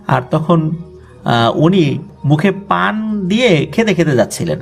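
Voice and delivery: plain reading voice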